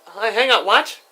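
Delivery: real loud, high-pitched call. Real, not acted. Speaker answering an interruption mid-recording, calling out loudly. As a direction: call across to whoever interrupted.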